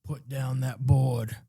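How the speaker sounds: slow, lightly voiced Con Air action hero; a hint of tough-guy Cage barking an order; Nicolas Cage impression. Podcaster mimics Con Air hero, slow mock tough order. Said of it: "I would have liked this movie better with the Nicolas Cage from Con Air".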